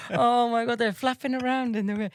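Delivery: high, giggly voice after a laugh; real amusement. Real, not acted. Telling a friend about the flapping ears, real amusement. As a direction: tell it while cracking up. Cracking up at her own story.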